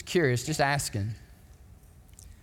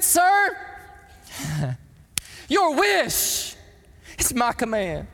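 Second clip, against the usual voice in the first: much higher, fervent reply of loyal duty; devoted soldier; 8 semitones higher, much slower than usual. Retold or imitated. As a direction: answer your commander with eager devotion. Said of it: "He said".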